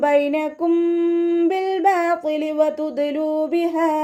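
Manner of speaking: high, slow, sung tajweed chant; Quran recitation. Acted. Teacher reciting the next verses in melodic chant. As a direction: draw each verse out melodically.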